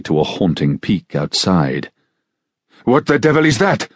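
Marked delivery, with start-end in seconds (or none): 2.9-3.9 sharp, much higher alarmed cry; startled cry. A narrator's reading. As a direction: cry out in shock at the howl. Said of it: "he cried".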